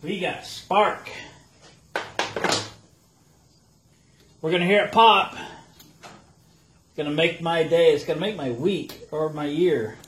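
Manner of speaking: gleeful build-up of anticipation; adrenaline rush. Real, not acted. Anticipating engine pop.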